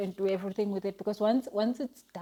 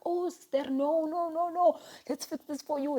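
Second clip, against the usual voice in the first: high-pitched mock concern; lifted voice, retelling a kindly protest; imitated eager helpers; 8 semitones higher than usual. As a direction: play helpers hurrying to take over. Acts out garage men rushing to help.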